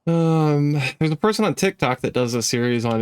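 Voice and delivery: usual speaking voice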